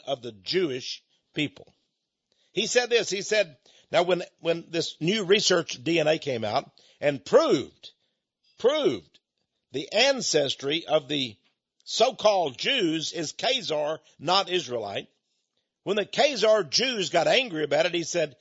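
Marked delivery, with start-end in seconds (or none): none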